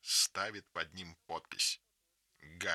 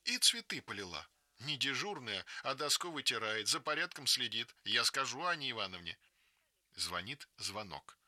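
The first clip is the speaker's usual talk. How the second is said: plain reading voice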